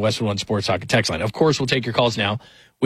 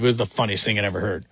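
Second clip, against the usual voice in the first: normal talking voice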